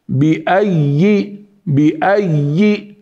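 بأي with a held double y; correct shadda. Shown as a demonstration. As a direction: lean on the ya in بأي. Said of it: "هذه الشدة". (بأي بتشديد الياء)

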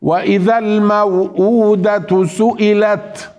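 slow, measured tajweed chant; Quran recitation. Shown as a demonstration. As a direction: recite with held vowels and nasal hums. (تلاوة مرتلة بالتجويد)

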